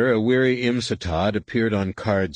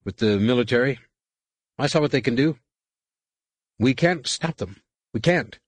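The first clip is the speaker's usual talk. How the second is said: higher, fast, pressing officer; a tense enemy captain arguing over the comm, higher and faster; Imsatad, hostile captain; 6 semitones higher than usual. A narrator's reading. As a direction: push your case quickly and insistently.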